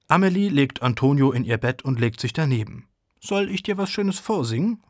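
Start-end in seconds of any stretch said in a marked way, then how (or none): 3.2-4.8 lightly voiced little girl. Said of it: "fragt Amelie".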